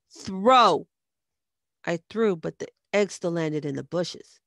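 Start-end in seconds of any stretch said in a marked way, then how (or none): 0.1-0.9 loud, drawn one-word order; barked command. A narrator's reading. Red pushing her toward the house, loud barked command. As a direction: order it with full force.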